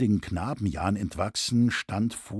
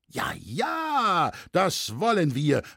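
high, drawn-out cries of agreement; crowd's eager shouts; 8 semitones higher, slower than usual. A narrator's reading. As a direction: cry out assent like an eager crowd. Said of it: "riefen alle durcheinander". (begeistert zustimmend gerufen)

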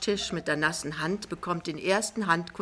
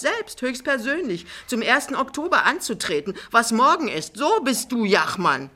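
higher, louder, mounting indignation; accusing Frau Pinneberg; 6 semitones higher, 7 dB louder than usual. Acted. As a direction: throw the accusation at him forcefully. Frau Pinneberg klagt Jachmann an. (laut und vorwurfsvoll anklagend)

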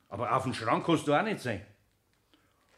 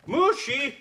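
high call for cat; 12 semitones higher, 4 dB louder than usual. Acted. Calling for lost cat.

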